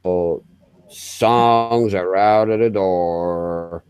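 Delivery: low, slow put-on southern voice; southern accent. Shown as a demonstration. Imitating Richard for the stream chat, slow low southern accent. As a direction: keep it low and lazy like a southern man. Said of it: "Richard southern accent there".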